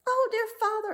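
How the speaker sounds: put-on high, eager young girl pleading; a young princess running to her father. Princess to her father, high and pleading.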